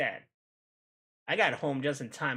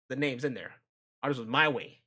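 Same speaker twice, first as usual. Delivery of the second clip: usual speaking voice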